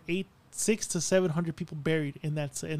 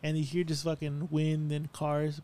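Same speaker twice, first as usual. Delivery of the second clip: usual speaking voice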